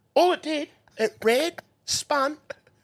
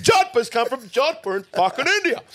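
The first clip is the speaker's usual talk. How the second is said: laughing shout; 10 semitones higher than usual. Real, not acted. Laughing correction of a word.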